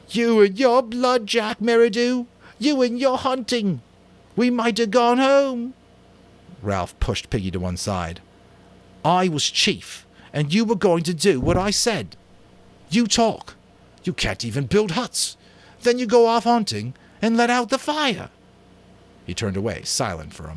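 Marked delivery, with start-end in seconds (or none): 0.1-5.7 shrill outcry. Acted. Piggy cries out at Jack over the lost ship, shrill. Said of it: "began to cry out shrilly". 9.0-18.3 furious scolding. Acted. Ralph scolds Jack as chief, furious.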